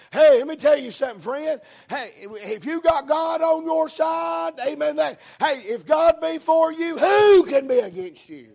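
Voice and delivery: loud, high, drawn-out pulpit shout; full-force preaching. Real, not acted. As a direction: proclaim it to rouse the congregation.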